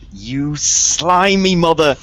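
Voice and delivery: loud, drawn-out angry insult; outraged cry before a punch; angry outburst. Acted. Player's character attacks the betrayer.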